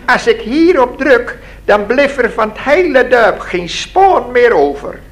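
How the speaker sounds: high-pitched little man with a threat; boastful little man Raket. Acted. Raket warns Lina about his button, high and boastful.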